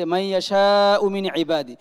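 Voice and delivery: "মাইয়াশাউ" slow, with the "shaa" held long; slow recited demo, long vowel in "মাইয়াশাউ"; lengthened madd. Shown as a demonstration. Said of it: "দুটি মদ কিন্তু সমান সমান".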